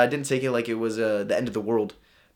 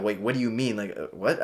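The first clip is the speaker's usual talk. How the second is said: confused; 6 semitones lower, 9 dB quieter than usual. Retold or imitated. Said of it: "I was just looking so confused".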